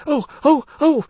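quick high sobbing oh's; sobbing wail. Acted. Narrator voicing her quick sobbing wail. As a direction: wail in short sobbing bursts. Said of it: "a sobbing wail of quick".

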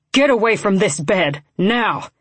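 mildly snarled command. A narrator's reading. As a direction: order him off with a contained snarl. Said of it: "he snarled".

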